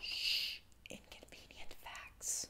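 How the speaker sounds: hushed whisper to the audience. Pretend. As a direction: whisper it like a secret. Whispered aside.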